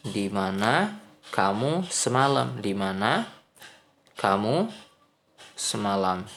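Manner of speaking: ordinary talk, nothing marked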